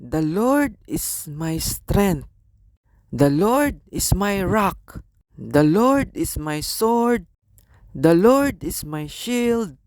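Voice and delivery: each line slow, leaning on Lord; slow psalm recitation. Acted. Preacher quotes the psalms to the congregation, slow and solemn. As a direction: recite it like scripture, deliberately.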